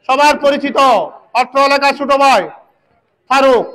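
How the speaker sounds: fast excited match commentary; frantic football commentary. Real, not acted. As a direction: call the action live, urgently. Live play-by-play commentary.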